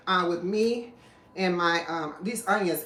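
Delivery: ordinary talk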